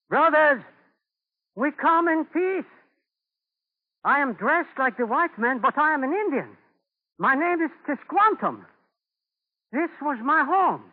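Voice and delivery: high, loud call across the woods. Acted. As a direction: project it to hidden figures in the trees. Calling out to hidden Indians.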